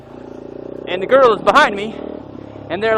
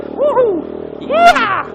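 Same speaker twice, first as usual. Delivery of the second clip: excited whoop; 4 dB louder, much slower than usual. Real, not acted. Cheering on a berm.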